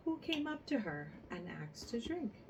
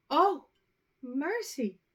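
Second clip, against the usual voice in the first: much higher and louder shriek of shock; horrified cry; 8 semitones higher, 12 dB louder, slower than usual. A narrator's reading. A mother seeing vipers fall from her daughter's mouth, horrified shriek. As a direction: shriek as if you've seen snakes. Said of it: "cried the mother".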